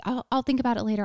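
rattled off fast, waving it away; tossing off a quick excuse; fast brush-off. Retold or imitated. Quoting her own excuse to herself, fast and dismissive. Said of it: "And I was just like".

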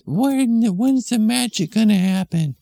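put-on high eager little kid; impatient small child asking. Kid pestering mom at the DMV, high childish voice.

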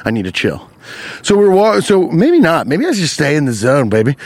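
ordinary talk

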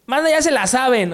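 a cocky chilango street call; Mexico City ñero accent. Shown as a demonstration. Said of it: "Aquí es un".

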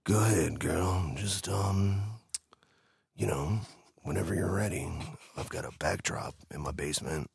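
low, ominous creep voice; ominous creepy voice. Retold or imitated. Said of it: "Do you know that voice scares people".

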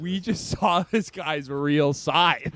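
surprised, drawn-out whoa; stunned. Retold or imitated. Friend watching the video, lightly stunned. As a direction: react like you just saw something shocking. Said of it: "And I'm just like".

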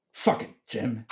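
quietly imitated exasperated curse; muttered curse. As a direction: mutter it to yourself in frustration. Woman swearing at husband quietly.

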